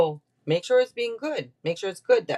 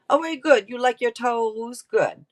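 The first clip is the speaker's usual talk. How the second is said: encouraging praise; 5 semitones higher than usual. Real, not acted. Praises the imagined viewer.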